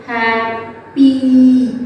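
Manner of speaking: happy stretched out very slowly; slow broken-up word. Shown as a demonstration. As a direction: break happy into slow sounds. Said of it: "You just slowly break that word".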